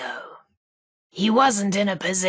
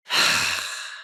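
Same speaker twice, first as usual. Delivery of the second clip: long, breathy sigh; weary sigh. Acted. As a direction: let out a resigned sigh. Alone after lamenting things getting worse.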